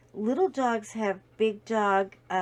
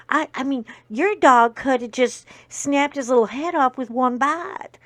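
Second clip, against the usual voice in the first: super Texan; 3 semitones higher, 11 dB louder than usual. Retold or imitated. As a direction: boom it out like a Texas mayor. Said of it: "super Texan".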